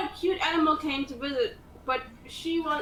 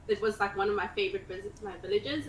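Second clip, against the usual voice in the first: normal talking voice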